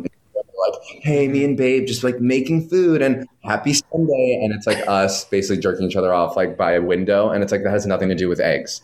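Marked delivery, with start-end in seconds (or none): 1.0-4.4 influencer voice. Retold or imitated. Mocking influencer husbands on the show, higher chirpy voice. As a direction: gush like a basic Instagram husband.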